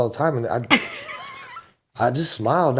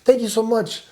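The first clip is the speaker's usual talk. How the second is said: higher, overly sweet thanks; mock sweet thanks, higher; sweet reply; 10 semitones higher than usual. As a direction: lay on the politeness. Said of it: "I was like".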